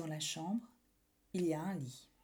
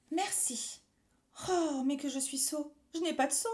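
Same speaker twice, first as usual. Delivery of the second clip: put-on small boy voice; 6 semitones higher than usual. Boy thanks, then dismay.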